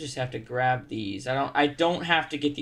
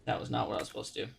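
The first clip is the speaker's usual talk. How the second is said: quick muttered aside; 5 semitones lower, 11 dB quieter, much faster than usual. Real, not acted. Rushed quiet annoyance.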